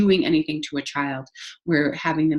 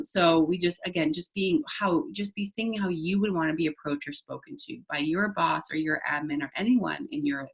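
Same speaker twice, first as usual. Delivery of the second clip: ordinary talk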